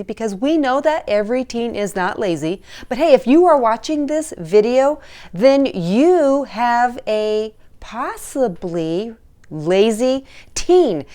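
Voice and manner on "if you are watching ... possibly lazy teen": ordinary talk, nothing marked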